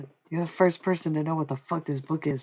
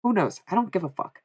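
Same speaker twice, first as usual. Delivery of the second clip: ordinary delivery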